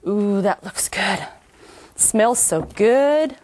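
slow, drawn-out delighted ooh; savoring delicious food. Real, not acted. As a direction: linger over every word, savoring it. Admiring the finished dish.

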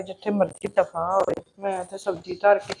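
resentful muttering aside; muttered under breath. Acted. Sulking sister repeats a curse.

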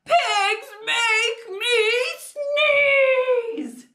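slow, high yell for others to join; really loud. Shown as a demonstration. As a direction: belt out each word, drawn out. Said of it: "Say it really loud with me".

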